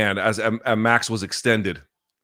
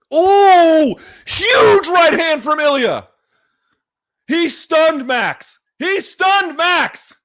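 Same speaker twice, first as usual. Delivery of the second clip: much higher and louder, slowed, yelling at the screen; excited shout; 9 dB louder, much slower than usual. Real, not acted. Streamer watching his fight live, shouting in real excitement. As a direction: erupt as the punch lands.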